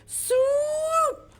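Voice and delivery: high, loud swooping cry. Acted. Showing kids a bird swooping, high and loud. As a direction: sweep the word up like a diving bird.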